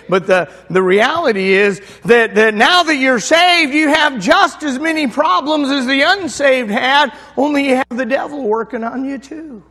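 fiery pulpit storytelling; full-force preaching. Acted. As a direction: drive the story home to the congregation. Preaching the point.

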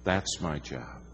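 hushed, falling to a near whisper on look good; muttering a rebuke to himself; quiet inner thought. Retold or imitated. Preacher retelling his own private thought, quietly. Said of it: "I thought".

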